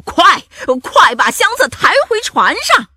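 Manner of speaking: high, loud pirate order; excited shouting. A narrator's reading. Pirate captain ordering crew to carry the chest, excited shout. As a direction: hurry the crew with an excited yell. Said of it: "兴奋地嚷道".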